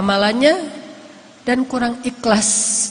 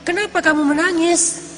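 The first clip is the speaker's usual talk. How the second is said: raised pitch, pressing for an answer; a friend's repeated, higher-pitched plea; insistent questioning; 6 semitones higher than usual. Retold or imitated. Teman terus mendesak bertanya. (bertanya mendesak dengan nada tinggi)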